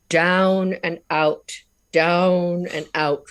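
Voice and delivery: slow, rounded English accent. Shown as a demonstration. Drama teacher's correction, in an English accent. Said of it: "like this, right? It's very English, very English".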